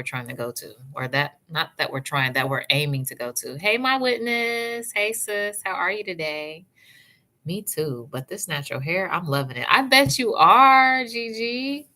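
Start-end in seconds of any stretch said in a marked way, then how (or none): 3.6-6.7 higher, slow, drawn-out hey to a viewer; excited greeting. Real, not acted. 9.7-11.9 high, loud and slow, cheering Gigi on; excited cheer. Real, not acted. Cheering a viewer's hair news.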